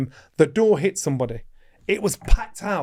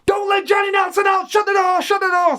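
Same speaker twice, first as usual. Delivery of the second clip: high, loud yell to shut the door; urgent shout; 4 dB louder than usual. Retold or imitated. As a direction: bellow across the gym to stop him. [name] shouting to block exit.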